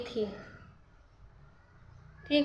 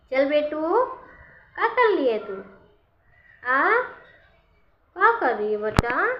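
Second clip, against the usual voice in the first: high, loud chatter to a pet bird; raised, lilting questions to a beloved pet; cooing to pet; 4 semitones higher, 4 dB louder than usual. Real, not acted. Woman checking on her pigeon, high pet-talk.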